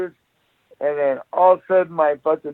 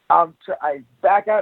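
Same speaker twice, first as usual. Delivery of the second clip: ordinary talk, nothing marked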